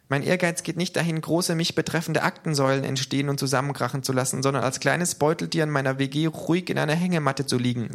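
high-pitched character voice; K, small creature voice. A narrator's reading. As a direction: speak high up as little K. (hohe Figurenstimme)

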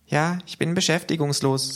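high-pitched character voice; K, small creature voice. A narrator's reading. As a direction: answer high up, as little K. (hohe Figurenstimme)

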